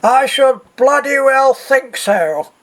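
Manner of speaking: put-on high, drawn-out fruity English accent. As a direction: imitate an indignant woman in a very fruity English accent. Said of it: "a woman with a very fruity English accent".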